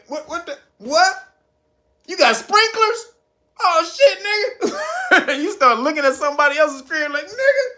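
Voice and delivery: "Oh, shit" cried out high in mock awe; amazed gawking. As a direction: act floored, half-laughing, by the sprinklers. Gawking at neighbor's nice house.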